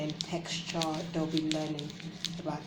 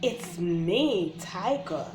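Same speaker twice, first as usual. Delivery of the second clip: put-on reading to children; slower than usual. Reading a baby book aloud.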